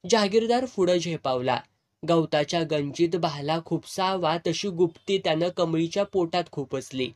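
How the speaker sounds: plain reading voice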